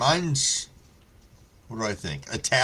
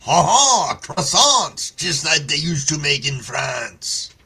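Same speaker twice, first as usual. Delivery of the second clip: hammed-up French accent, louder and higher; ooh-la-la French caricature, raised and loud; 4 semitones higher, 6 dB louder than usual. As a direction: play a mock Frenchman bragging about croissants. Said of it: "With a French accent".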